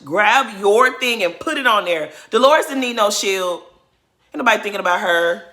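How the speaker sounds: exasperated loud lecture at the TV; loud scolding. Real, not acted. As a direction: scold the player like she can hear you. Scolding the player on screen.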